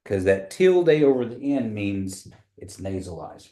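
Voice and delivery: ordinary talk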